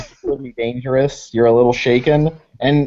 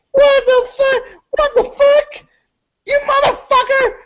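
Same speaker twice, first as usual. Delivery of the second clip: very high, panicky squawk; crazed high-pitched outburst; freaked-out crackhead; 3 dB louder than usual. Acted. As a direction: squeal it, completely freaked. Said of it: "he is fucking freaked at that".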